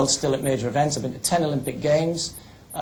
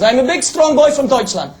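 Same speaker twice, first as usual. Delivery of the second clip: much higher, louder mock German accent; 11 semitones higher, 6 dB louder than usual. German lad bluffing the guard, loud high mock German accent. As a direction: ham up a German athlete introducing himself. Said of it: "the German lad says".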